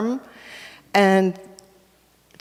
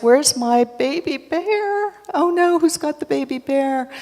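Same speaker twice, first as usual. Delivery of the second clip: worried mother bear; 7 semitones higher than usual. A narrator's reading. Mother bear missing her cub.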